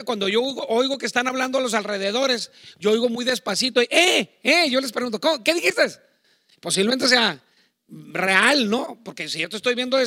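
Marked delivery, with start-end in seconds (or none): 3.9-4.7 higher, louder puzzled "eh, eh"; confused half-deaf "eh". Retold or imitated. Pastor imitating himself not hearing his family, loud and puzzled. 5.2-5.9 higher and louder, asking them to repeat; hard-of-hearing "what?". Retold or imitated. Pastor imitating himself asking his family to repeat, loud puzzled.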